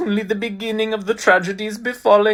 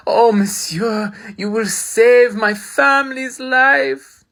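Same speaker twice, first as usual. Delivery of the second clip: slow gushing gratitude; 6 semitones higher, 6 dB louder, slower than usual. Acted. Gushing thanks to benefactor.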